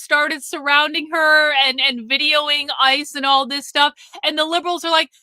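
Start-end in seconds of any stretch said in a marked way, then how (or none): none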